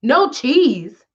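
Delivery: high, startled mock shout; mock yell. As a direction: yell it out in disbelief. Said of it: "him yells out".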